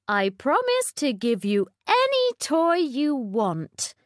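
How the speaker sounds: lightly voiced queen; a hint of a kind queen. A narrator's reading. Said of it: "the Queen said".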